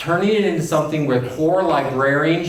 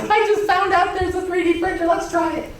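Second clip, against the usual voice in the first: much higher, bright-eyed rush of enthusiasm; eager crazy-idea leader; 12 semitones higher than usual. Retold or imitated. Keynote speaker mimicking an excited leader with a new 3D printer idea. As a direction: play the giddy leader who just found a new toy. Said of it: "the crazy eye leader, that goes back and says".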